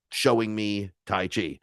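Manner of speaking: ordinary talk, nothing marked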